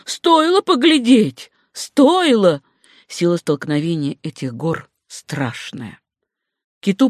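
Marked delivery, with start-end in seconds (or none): 0.0-2.6 high, slow, drawn-out approval; high, savouring exclamation; emphatic exclamation. A narrator's reading. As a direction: stretch it out high, full of appreciation. Doctor marvels at the collision.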